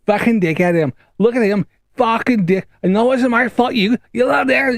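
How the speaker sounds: high, furious Scouse rant; high, raging Liverpool accent; angry Scouse accent. Retold or imitated. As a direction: let rip in full Scouse at the lot boy. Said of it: "Why am I going really Scouse?".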